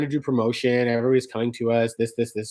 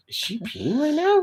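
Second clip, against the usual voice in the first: mock wondering; 12 semitones higher, 4 dB quieter than usual. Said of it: "And I'm like".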